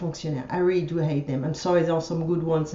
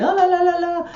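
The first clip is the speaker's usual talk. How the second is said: mock war chant; 9 semitones higher than usual. Mocking a bought private army with weapons, high mock war cry. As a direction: imitate a wild battle cry, pitched way up.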